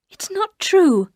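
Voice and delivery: high, slow, pained denial; upset denial. Acted. Narrator denying Danvers' claim, high and upset. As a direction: push back hard, voice climbing. Narrator denies husband's unhappiness.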